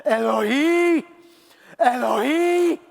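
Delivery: high, loud, long-drawn cry; cry from the soul. Acted. Jesus crying out from the soul, loud drawn-out cry. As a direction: wail it slowly up to heaven. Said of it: "something breathy from the soul".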